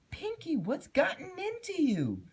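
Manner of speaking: very high worried pony voice; frantic. A narrator's reading. Twilight begging Pinkie to explain, very high and frantic. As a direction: plead with rising panic. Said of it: "Twilight said frantically".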